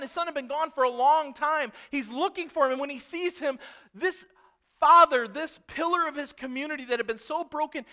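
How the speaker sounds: high, loud, driving sermon delivery; excited preacher carried along by the story; impassioned preaching. Real, not acted. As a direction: tell it at full preaching force, building urgency. Preacher at full force.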